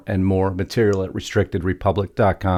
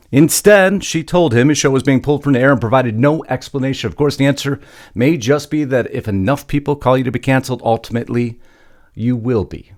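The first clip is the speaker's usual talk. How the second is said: higher, punchy news-script read; news story read; 5 semitones higher, 5 dB louder than usual. Acted. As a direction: open high and loud, then drive through the story.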